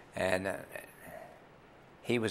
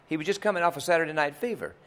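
usual speaking voice

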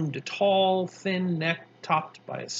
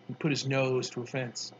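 usual speaking voice

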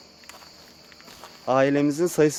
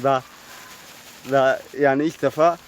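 normal talking voice

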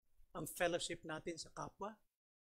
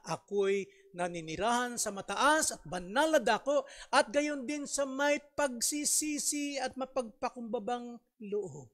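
scripture reading; 5 semitones higher, 6 dB louder than usual. Acted. Quotes scripture with force.

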